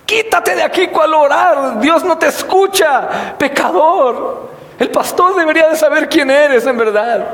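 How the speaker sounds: high, scolding, contemptuous voice; scornful self-righteous believer. Pretend. Mocking a self-righteous believer who refuses to pray, high scornful scolding.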